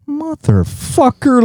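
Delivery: a slow, sky-high "Motherfucker" of disbelief; retelling a friend's turnaround, slow high disbelief; drawn-out mock outrage. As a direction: stretch it out high and slow, incredulous. Realizing friend's hypocrisy.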